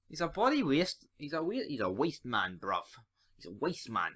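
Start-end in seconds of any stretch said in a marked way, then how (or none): 1.2-4.1 lightly put-on London street slang, quieter; mock London roadman slang. Scottish fan mocking the manager in London slang, lightly done. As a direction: drop into a jokey roadman voice for the insult. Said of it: "we don't talk like that here".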